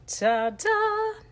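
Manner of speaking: high, proud ta-da; high ta-da reveal. Real, not acted. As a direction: present it with a flourish. Revealing the stamped image.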